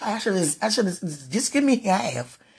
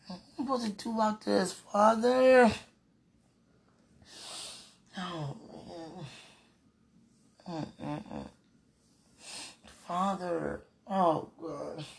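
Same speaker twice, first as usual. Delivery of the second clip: hushed pleading; 9 dB quieter, slower than usual. Real, not acted. Softening pleas to Father.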